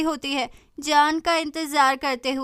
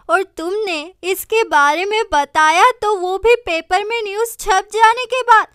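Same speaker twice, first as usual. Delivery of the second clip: loud, higher scolding mom voice; scolding mother; 3 semitones higher, 6 dB louder than usual. Acted. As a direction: scold her daughter, loud and high. Mother scolds daughter about rich man. (माँ की ऊँची आवाज़ में डाँट)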